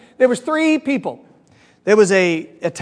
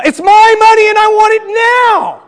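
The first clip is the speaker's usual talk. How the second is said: drawn-out, high-pitched yell; big mimicked TV-commercial shout; 12 semitones higher, 7 dB louder, slower than usual. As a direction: holler it slowly like the guys in the ad. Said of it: "stick their head out a window".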